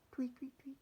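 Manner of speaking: very high soft tweeting; bird chirps. Acted. Bird chirping at the witch, high and soft.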